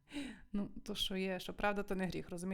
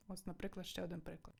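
much softer repeat; 2 semitones lower, 10 dB quieter than usual. Shown as a demonstration. Teacher repeats the phrase softer.

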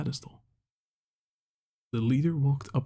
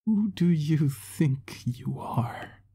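very slow whispered anger; hint of rage; 3 semitones higher, much slower than usual. A narrator's reading. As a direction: hold back the rage. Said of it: "A hint of rage mixed with his words".

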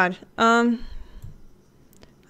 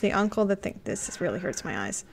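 ordinary talk

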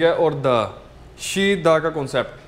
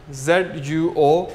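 slow spelling; much slower than usual. Shown as a demonstration. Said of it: "spelling letters slowly".

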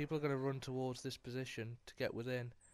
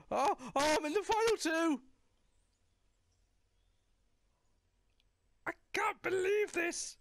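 high, thrilled yelling; shouting with joy; ecstatic shout; 3 dB louder than usual. Real, not acted. Reaches final two in game.

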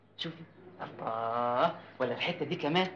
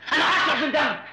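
high, loud threat of revenge; husband vowing to drink his brother's blood, raging; furious threat; 8 semitones higher, 4 dB louder than usual. Acted.

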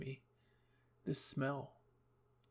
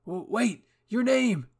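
high, loud stammered call; 8 semitones higher, 12 dB louder, slower than usual. Acted. Eren calling after someone leaving, urgent high shout. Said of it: "Eren called out".

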